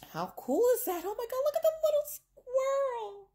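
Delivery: high, squeaky delighted gushing; high cute voice. Real, not acted. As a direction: coo over something adorable. Cooing at the tiny squirrel.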